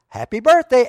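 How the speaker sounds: loud, high "Happy birthday" call; shouted greeting. Retold or imitated. Tony springing the surprise party on Agnes, loud high call.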